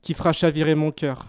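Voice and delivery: measured, projected lines with pauses between phrases; slam poetry recitation. Acted. Slam poet thanking companions for the adventure, rhythmic declaiming.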